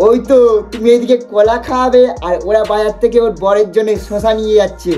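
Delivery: ordinary talk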